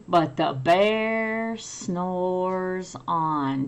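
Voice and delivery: slow, drawn-out refrain for joining in. Shown as a demonstration. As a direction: drag it out so kids can join. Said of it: "Do it with me".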